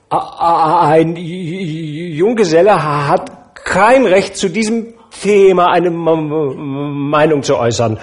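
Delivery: flustered suitor tripping over his words; nervous stuttering Topper; stammering bachelor. Acted.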